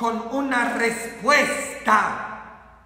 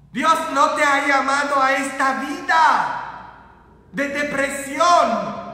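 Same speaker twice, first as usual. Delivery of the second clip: emphatic preacher, building louder and higher; forceful preaching; 4 semitones higher, 5 dB louder than usual. Acted. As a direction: proclaim it to the congregation with mounting force.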